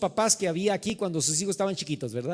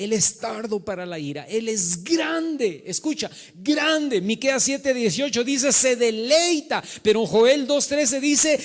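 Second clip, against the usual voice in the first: building, drawn-out sermon cadence; emphatic preaching; 6 semitones higher, 7 dB louder, slower than usual. Acted. Pastor quoting Joel to the congregation, building emphatic preaching. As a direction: stretch the key words as you proclaim.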